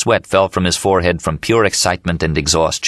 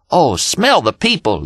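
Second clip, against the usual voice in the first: higher, slow, ecstatic call out the window; excited yell; 9 semitones higher, slower than usual. Acted. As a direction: drink in the city air with a cry. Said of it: "yelled Dean".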